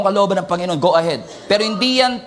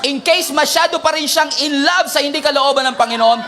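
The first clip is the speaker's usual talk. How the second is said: fiery exhortation; 5 semitones higher than usual. Real, not acted. Preacher shouting at the congregation to wake neighbours. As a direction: wake them up with force.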